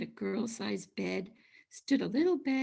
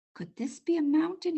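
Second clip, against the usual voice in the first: high, wide-eyed wonder; 7 semitones higher, 5 dB louder than usual. A narrator's reading. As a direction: marvel at it like a small bear. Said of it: "he wondered".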